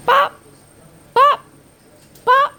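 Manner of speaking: high, loud popping sounds; three loud, separate high pops; popping noise. Pretend. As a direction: pop it out sharply, high above talk. Imitates popping noises while playing.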